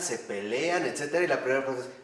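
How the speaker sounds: ordinary delivery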